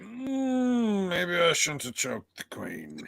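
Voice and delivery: sheepish regret. Acted. Friend voices the character's second thoughts, mock sheepish. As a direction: let a hummed "Mm" slide down into mild regret.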